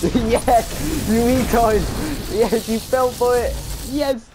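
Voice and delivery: high, loud, jubilant crowing; exultant taunting yell; gloating victory shout. Real, not acted. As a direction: crow over a trick that worked. Gloats after tricking opponent.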